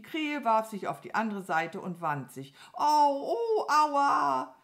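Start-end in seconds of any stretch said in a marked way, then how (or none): 2.7-4.5 loud, high howl; hammed-up cries of pain; theatrical pain. A narrator's reading. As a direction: wail as if writhing in agony. Crow writhes in feigned pain.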